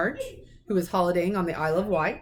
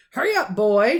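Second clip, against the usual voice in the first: loud, impatient bellow from the kitchen; a gruff uncle shouting across the house; shouted order; 3 semitones higher, 9 dB louder than usual. A narrator's reading. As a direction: yell at the boy to hurry. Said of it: "shouted Uncle Vernon".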